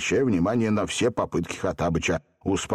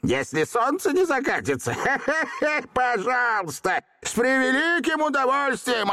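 gloating genie; 9 semitones higher than usual. Acted. Gloating laugh, old genie.

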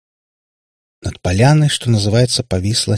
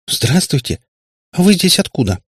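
put-on high, puzzled girl's voice; недоумение; 9 semitones higher than usual. A narrator's reading. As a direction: ask in innocent bewilderment. Bewildered girl greets Katya's father. (высоко, с недоумением)

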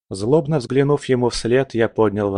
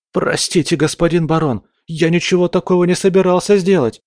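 put-on higher, scared pleading young man; frightened babbling; 9 semitones higher, 5 dB louder than usual. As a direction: babble it out in panic. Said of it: "залепетал парень".

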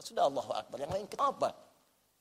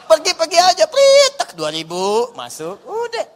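laughing; 9 semitones higher, 13 dB louder, slower than usual. Acted. Joking about parking attendant.

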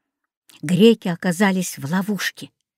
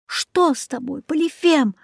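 calling out; 7 semitones higher than usual. A narrator's reading. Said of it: "закричали циклопы".

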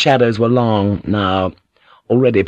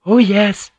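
high, proud maid's assurance; lifted, proudly certain reply; 11 semitones higher, 4 dB louder than usual. A narrator's reading. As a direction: answer with a touch of pride. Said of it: "Chimoko said proudly".

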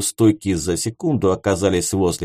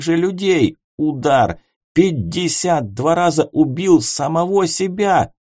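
furious shouting; 6 semitones higher than usual. Acted. Shouting while beating him.

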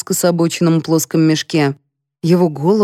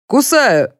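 shouting; 6 semitones higher than usual. A narrator's reading. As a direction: yell the cue. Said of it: "заорал полковник". (крик)

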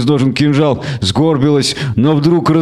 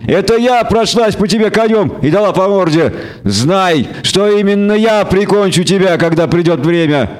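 higher, cold and scornful; scornful elf woman; 7 semitones higher than usual. A narrator's reading. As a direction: throw his past defeat back at him.

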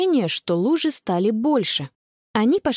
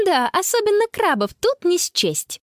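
high, mocking child voice; giggly teasing; 8 semitones higher than usual. A narrator's reading. Connie mocking grandpa's missing crabs, amused. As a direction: tease him, pitched right up. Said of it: "хихикнула Конни".

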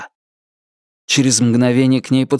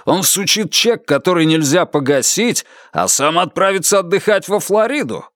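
high-pitched indignant accusation; outraged, climbing higher to the end; 6 semitones higher than usual. A narrator's reading. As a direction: protest as if being swindled. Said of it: "возмутился Стив Бернхард".

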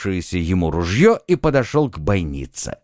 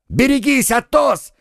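high urgent cry of warning; warning shout; 13 semitones higher than usual. A narrator's reading. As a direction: yell it out to warn a friend. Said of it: "крикнул Д'Артаньян".

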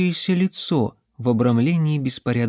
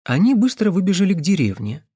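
ordinary delivery, nothing marked

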